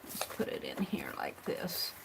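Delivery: breathy whispered aside to herself; soft unvoiced murmur while working. Real, not acted. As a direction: whisper it, almost to yourself. Quiet aside while arranging.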